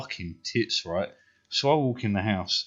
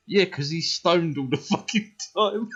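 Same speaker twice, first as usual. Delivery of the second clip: high, laughing retort; 8 semitones higher than usual. Retold or imitated. Mum's mate telling mum he's always stoned, laughing. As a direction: laugh through the punchline.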